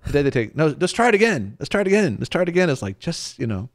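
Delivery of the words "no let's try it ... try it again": insistent, higher pitched: let's try again; eager retake. Retold or imitated. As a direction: keep pushing for one more shot. Retaking a photo over and over.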